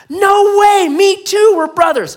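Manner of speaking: very high, very loud mock cheer; overjoyed disbelief. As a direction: whoop it in hammed-up amazement. Rescuer rejoices more.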